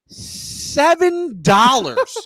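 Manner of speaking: high, very slow, stretched disbelief; incredulous outrage. Real, not acted. Customer telling what one slice of pizza cost, incredulous outrage. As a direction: draw it out high, scandalised by the price.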